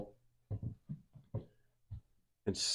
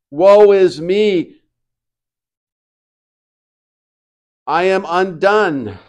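loud, slow cry of despair; slow loud anguish, a touch hammed-up; anguished lament; 5 semitones higher, 7 dB louder, slower than usual. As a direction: wail it as a man undone. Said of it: "It says".

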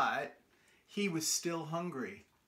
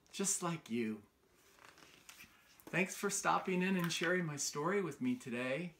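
ordinary delivery, nothing marked

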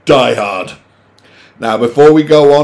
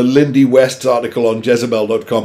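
ordinary talk

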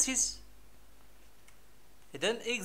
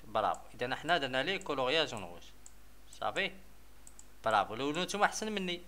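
usual speaking voice

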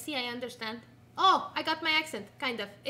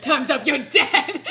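mock threat; 10 dB louder, slower than usual. Teasing playful mock threat.

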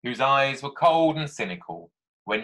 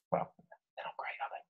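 hushed conspiratorial whisper; whispered aside; 14 dB quieter, faster than usual. Real, not acted. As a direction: lean in and whisper it like a secret. Cheeky aside about posh people.

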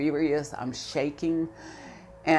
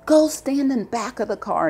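higher, louder officer command; shouted order; 8 semitones higher, 8 dB louder than usual. Retold or imitated. Officer ordering the driver behind her car, shouting. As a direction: order her out of the way at full volume. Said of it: "And he says".

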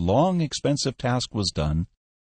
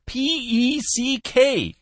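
high, slow letter-by-letter call; exasperated spelling; much slower than usual. A narrator's reading. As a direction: spell it out for someone who can't hear. Caller spells name to listener.

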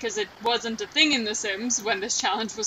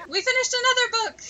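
excited; 11 semitones higher than usual. Real, not acted. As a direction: cheer it like a small victory. Celebrating finished book in game.

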